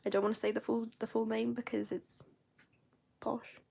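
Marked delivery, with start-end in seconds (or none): 1.9-3.6 secretive whisper. Real, not acted. Whispering the embarrassing word.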